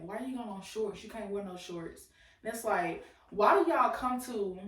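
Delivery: ordinary delivery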